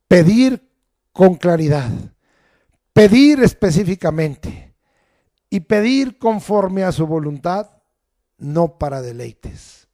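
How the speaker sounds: loud, emphatic preacher's voice; preacher exhorting on prayer; fervent preaching. Acted. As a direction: hammer each point home. Preaching at full force.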